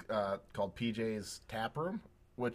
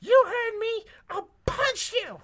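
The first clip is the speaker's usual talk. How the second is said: high, loud shriek, the last words dragged slow; screaming; 8 dB louder, much slower than usual. Retold or imitated. Restaurant lady repeats her threat, high shrieking yell. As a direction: shriek the threat all over again. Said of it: "and then she goes".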